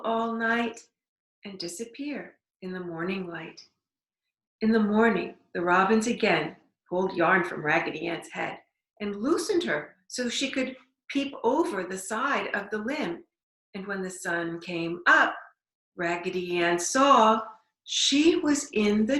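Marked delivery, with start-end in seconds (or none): none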